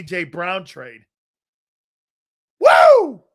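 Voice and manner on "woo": loud, high-pitched woo; excited whoop. Real, not acted. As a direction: let out a big hyped whoop. Host whoops after trade pitch.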